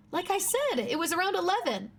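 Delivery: high-pitched witness voice; insistent witness. Acted. Witness repeating the time to the lawyer, high insistent voice. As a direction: answer insistently in a high character voice.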